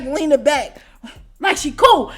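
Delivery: ordinary talk, nothing marked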